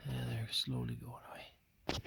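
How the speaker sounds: soft, breath-only wildlife watcher's whisper; hushed whisper. Real, not acted. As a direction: whisper so the birds aren't spooked. Sneaking up on wary cranes.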